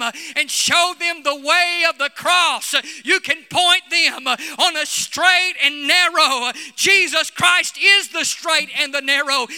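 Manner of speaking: loud, rising Pentecostal preaching with shouts; full-force preaching. Acted. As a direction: preach it hard and stir the crowd.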